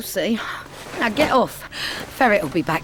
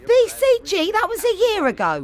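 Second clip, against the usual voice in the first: incredulous; 13 semitones higher than usual. Real, not acted. Mum disbelieving her son's old injection complaint. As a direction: yelp it back as if he's mad.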